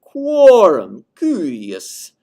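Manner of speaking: slow, deliberate fall. Shown as a demonstration. Teacher repeating falling relative forms. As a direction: drop through each word. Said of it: "lower pitch, but it's also falling".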